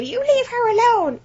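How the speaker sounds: high-pitched scolding cry; protective elderly woman. Retold or imitated. Elderly customer defends crying employee, high indignant scolding. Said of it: "hugged her and said to him".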